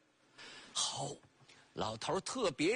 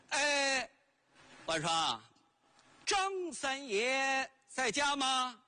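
slow call from outside the gate; drawn-out call over the fence; 5 semitones higher, slower than usual. Acted. Calling from outside the yard. (在院外拖长声喊人)

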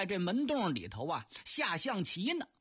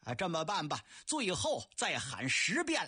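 ordinary delivery

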